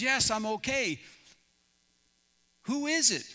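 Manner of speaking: acted stranded man shouting upward; Jack. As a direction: call up from a cliff edge. Stranded Jack calls back up.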